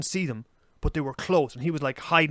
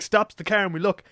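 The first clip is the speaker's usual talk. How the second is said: ordinary talk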